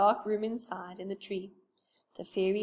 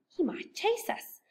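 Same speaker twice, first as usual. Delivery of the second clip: Beth's "chase" jumps much higher, rising; high rising worry; 11 semitones higher than usual. Acted. Beth warning Joe not to wake the gnome, high and rising. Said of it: "said Beth".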